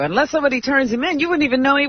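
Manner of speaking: ordinary talk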